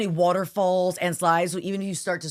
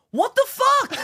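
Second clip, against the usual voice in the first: put-on much higher, louder kid's outraged cry; upset little boy; 5 dB louder, slower than usual. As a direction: squeal it like a furious little boy. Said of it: "he goes".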